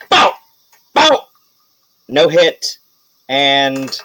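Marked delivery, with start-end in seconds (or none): 0.1-1.3 high, punchy "bow" blasts; imitated shot noises. Game master firing for the knight, high shot noises. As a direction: pop out each shot sharply.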